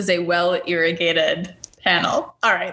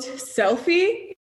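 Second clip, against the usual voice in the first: air quotes; 8 semitones higher than usual. Shown as a demonstration. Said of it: "quote, end quote".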